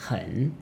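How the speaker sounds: "hen" soft, low, dipping then rising; teacher tone. Shown as a demonstration. Teacher demonstrating the next word, "hen" dipping then rising. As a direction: scoop "hen" down and up. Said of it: "also a teacher tone".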